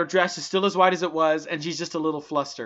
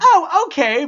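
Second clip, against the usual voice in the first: mock surprised; 10 semitones higher, 10 dB louder, slower than usual. Imitating people at school.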